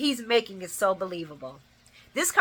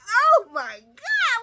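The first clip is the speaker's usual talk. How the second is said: laughing disbelief; 6 dB louder, slower than usual. Real, not acted. Her own shocked reaction.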